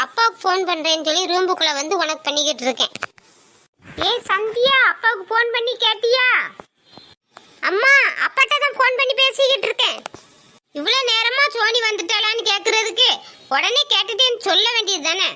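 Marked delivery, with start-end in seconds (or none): 4.1-15.3 a lightly higher voiced nagging mother. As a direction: play the mother calling her daughter, a bit higher.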